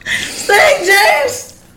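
high, loud laughing shout; church cheer. Retold or imitated. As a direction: whoop it again like the church ladies. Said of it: "The women in church jumped up like".

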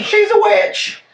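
very high, slow mock shriek; mock witch-hunt cry. Villager accusing a neighbor of witchcraft, mock shriek. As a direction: squeal a pretend accusation.

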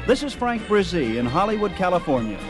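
ordinary talk

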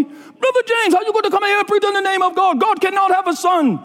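very high-pitched mock confrontation; indignant outburst. Pizza shop men confronting Brother James over his prayer, indignant outburst. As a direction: imitate an outraged objection, all on one high pitch.

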